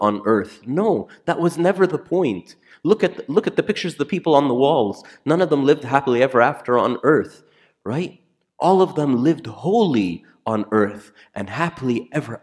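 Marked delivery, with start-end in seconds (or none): none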